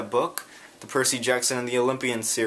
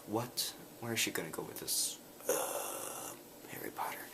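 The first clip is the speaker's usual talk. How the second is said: sheepish mumble; 14 dB quieter than usual. Retold or imitated. Eli unsure, answering under breath.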